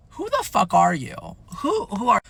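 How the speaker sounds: startled, faltering demand. Retold or imitated. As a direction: retell being thrown, pitch jumping on the repeat. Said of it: "I kinda get startled by that".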